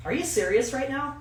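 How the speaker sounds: high-pitched imitation of disbelief; incredulous. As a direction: challenge them, mock incredulous. Cashier doubts customer's fake ID.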